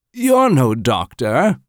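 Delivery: put-on high, slow, accusing old woman's voice; suspicious older woman. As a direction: size him up with open doubt. Older woman challenges Robert.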